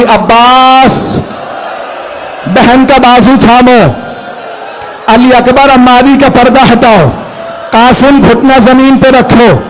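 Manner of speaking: high, sustained recited commands; lamenting preacher's chant; majlis recitation. Acted. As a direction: chant the orders to the sons in rising grief. Husain's commands, chanted at rising pitch.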